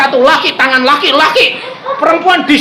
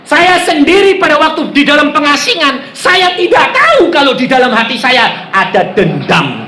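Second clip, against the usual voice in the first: raised, high-pitched exhortation; fervent sermon delivery; forceful preaching; 8 semitones higher, 3 dB louder than usual. Real, not acted. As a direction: drive it home to the congregation.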